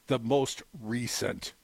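low, drawn-out, falling finish; slow quote ending. Retold or imitated. Closing a quoted Garland statement, slow and low. Quote ends, then repeated for emphasis.

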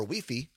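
Wi-Fi said as "wee-fee"; Spanish pronunciation. Shown as a demonstration. Host plugging the app's listening options, Wi-Fi as Spanish "wee-fee". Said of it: "That's how they say it in Spanish".

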